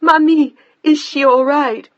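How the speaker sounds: high, loud panicked plea; frightened, near tears. A narrator's reading. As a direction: fight back tears while shouting. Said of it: "desperately trying to control her voice".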